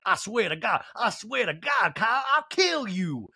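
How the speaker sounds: high, drawled mock threat; mock rebellion. Housewife threatening friend who grabbed her phone, mock outrage. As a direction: threaten theatrically, pitch climbing. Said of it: "Sutton rebelling was like".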